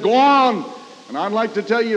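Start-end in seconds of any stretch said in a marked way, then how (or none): 0.0-0.6 raised, drawn-out urging call; retold tempter's voice, pushing hard; strong devil's voice. Devil urging a desperate man toward the bridge, strong insistent voice. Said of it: "the devil's voice is pretty strong".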